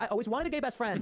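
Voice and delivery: high, gushing mock woman; mock gushing lady at the bar. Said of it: "they're gonna be like".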